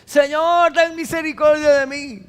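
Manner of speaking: clamor agudo desde lejos; grito de súplica. Retold or imitated. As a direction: grita pidiendo misericordia. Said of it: "le gritó de lejos". (mujer cananea grita a Jesús de lejos, suplicante)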